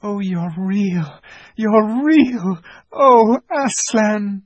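put-on very high, slow joyful girl; bursting with relief; overjoyed cry. A narrator's reading. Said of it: "cried Lucy".

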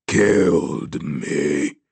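low, very slow ghostly rasp; deep, drawn-out rasping dead man. A narrator's reading. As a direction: drag the accusation out in a rasp. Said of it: "the rasp from his throat grew louder".

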